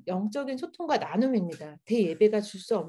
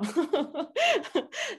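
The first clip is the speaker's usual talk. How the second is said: light laugh; 7 semitones higher than usual. Real, not acted. Self-deprecating joke about family.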